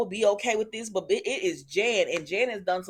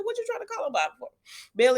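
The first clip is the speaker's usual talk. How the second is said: incredulous; 11 semitones higher, faster than usual. Real, not acted. Talks back at Bill on TV.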